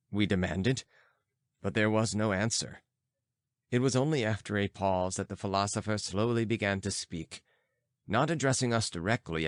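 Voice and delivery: ordinary delivery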